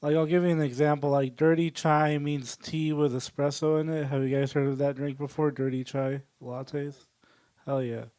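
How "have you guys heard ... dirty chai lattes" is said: usual speaking voice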